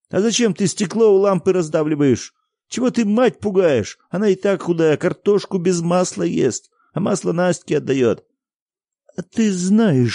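high, loud, angry voices; heated argument. A narrator's reading. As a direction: argue hard, don't back down. Boy and father arguing.

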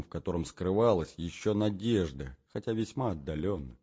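ordinary delivery, nothing marked

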